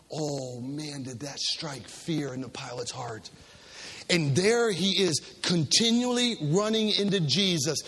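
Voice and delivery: normal talking voice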